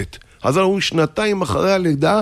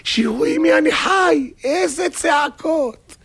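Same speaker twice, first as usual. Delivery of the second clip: very high, drawn-out indignant yell; 12 semitones higher, slower than usual. Acted. Husband shouting out the window about his wife, high yell. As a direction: yell it out for the whole street.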